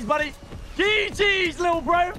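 high, loud gloating shout; shrieking good game, little bro at a beaten opponent; mocking taunt. Real, not acted. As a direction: crow over the loser. Taunting defeated player.

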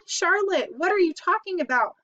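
high-pitched, dismayed questioning; alarmed cry. A narrator's reading. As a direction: cry out in shocked disbelief. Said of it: "he cried".

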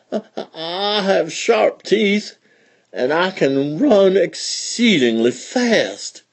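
slow, high, stammering fox; unsteady voice. Acted. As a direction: hold back a whimper as you speak. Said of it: "his voice was real unsteady like".

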